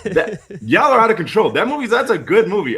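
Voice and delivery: high and loud, fired up; excited outburst. Real, not acted. Reviewer to the critic panelists, pitched high and fired up. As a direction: burst out defending the film.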